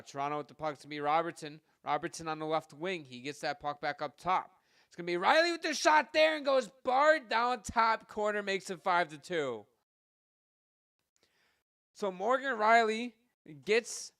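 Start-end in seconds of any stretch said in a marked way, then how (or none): 4.9-9.6 much higher and louder, soaring on the top-corner goal; excited goal call. Real, not acted. Goal scored during play-by-play. 11.9-14.1 higher, louder name of the scorer; excited naming of the scorer. Real, not acted. Goal scorer named after goal.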